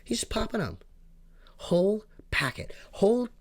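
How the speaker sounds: aghast at a whole packet gone; incredulous exasperation. Real, not acted. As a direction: squeal it like you still can't believe it. Ranting about gum-chewing kid.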